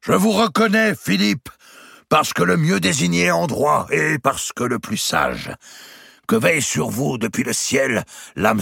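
strong voice. A narrator's reading. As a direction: swear allegiance with full force before the barons. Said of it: "continuait d'une voix forte".

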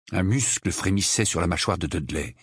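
plain reading voice

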